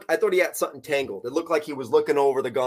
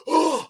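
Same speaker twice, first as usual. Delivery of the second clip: high gasp for air; imitated gasping; 13 semitones higher, 3 dB louder than usual. Imitating the friend pulled up from the water, high gasp. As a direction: suck in air like surfacing from water.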